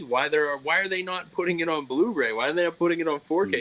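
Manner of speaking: normal talking voice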